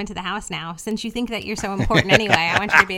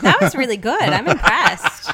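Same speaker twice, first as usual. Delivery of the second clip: laughing; 3 semitones higher, 7 dB louder, slower than usual. Real, not acted. Laughing at partner's voice.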